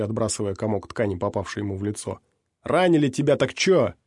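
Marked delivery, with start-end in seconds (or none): 2.6-3.9 scornful retort. A narrator's reading. Skinny man retorts.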